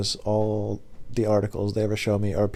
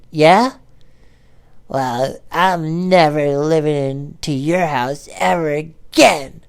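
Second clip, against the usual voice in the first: slow, drawn-out parting shot, pitched up; mock defiant comeback; 5 semitones higher, 4 dB louder, much slower than usual. Driver turning back to the customer, slow hammed-up defiance. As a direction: stretch every word of the comeback.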